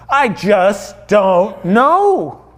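very slow, word-by-word reply; clueless thief. Acted. Thief answering the puzzled angel, slow and clueless. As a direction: drag out each word, helplessly at a loss.

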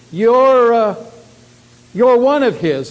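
loud, high-pitched accusing call; loud accusation. Acted. Servant girl accusing Peter before others, loud and accusing. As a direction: point someone out loudly to everyone.

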